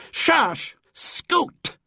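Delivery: very high shoo, breathy scoot; shooing a cat. A narrator's reading. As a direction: shoo the cat away as you throw. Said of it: "he said as he did it".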